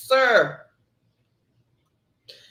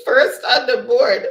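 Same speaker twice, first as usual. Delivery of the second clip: laughing out the words, louder; 6 semitones higher, 8 dB louder than usual. Real, not acted. Host announcing the scores to players, laughing. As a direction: burst out laughing while announcing.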